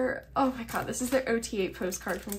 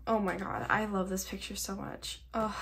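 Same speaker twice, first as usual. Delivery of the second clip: ordinary delivery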